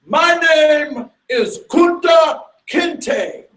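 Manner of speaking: slow, high, loud proud answer; proud declaration. Retold or imitated. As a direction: stand tall and declare your name. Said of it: "And he says proudly".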